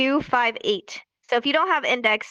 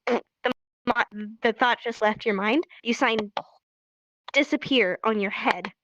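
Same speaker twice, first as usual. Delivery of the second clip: ordinary talk, nothing marked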